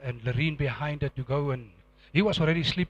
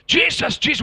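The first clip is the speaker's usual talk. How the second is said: raised, high mock shout; retold shouting; 7 semitones higher, 6 dB louder than usual. Preacher re-enacts reaching the gunman, calling out loudly. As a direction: call the name twice in quick succession, loudly. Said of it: "I shouted".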